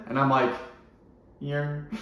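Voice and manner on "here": barely audible mumbled here; tiny voice, almost nothing; super quietly. Retold or imitated. Shy kid answering roll call very quietly. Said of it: "like, super quietly".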